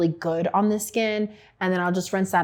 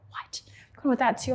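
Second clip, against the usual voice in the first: incredulous whisper; 5 semitones higher, 4 dB quieter than usual. Retold or imitated. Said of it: "They're like".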